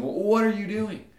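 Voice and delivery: God. Acted. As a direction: demand it like an irritated deity. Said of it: "it is big angry God that shows up".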